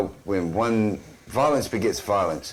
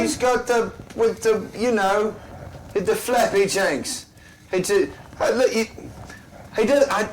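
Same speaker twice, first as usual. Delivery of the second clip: high comic voice; 10 semitones higher than usual. Acted.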